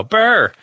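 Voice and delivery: high, loud brr; shivering brr. Acted. Shivering sound.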